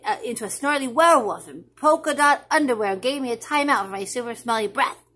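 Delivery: put-on excited child.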